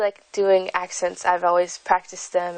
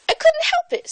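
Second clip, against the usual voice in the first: much higher, sulky British gardener. Acted. Five sulks to Seven about the paint, high British accent. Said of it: "said Five in a sulky tone".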